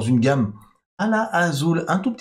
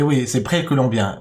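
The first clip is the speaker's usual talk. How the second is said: normal talking voice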